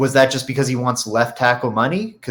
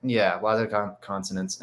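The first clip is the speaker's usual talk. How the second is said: blocked nose, quiet; stuffy nose; 3 semitones lower, 7 dB quieter than usual. Real, not acted. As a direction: talk through a stuffy nose. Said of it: "and I'm stuffy".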